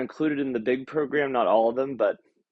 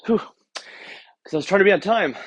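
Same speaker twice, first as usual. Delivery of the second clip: out of breath; 6 semitones higher, 4 dB louder than usual. Real, not acted. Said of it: "out of breath getting up the stairs".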